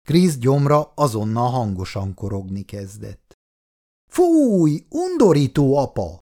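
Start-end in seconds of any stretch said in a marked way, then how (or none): 4.1-6.2 high, loud, drawn-out "ew"; eye-rolling disgust. A narrator's reading. Daughter to father over his rumbling stomach, disgusted. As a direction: recoil like a grossed-out daughter. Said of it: "mondta Jane a szemét forgatva".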